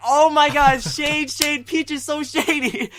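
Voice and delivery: high, loud scandalized exclamation; gleeful mock shock. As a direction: squeal it with delighted, laughing scandal. Gleeful retold reaction to Peach's dig.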